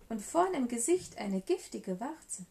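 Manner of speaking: usual speaking voice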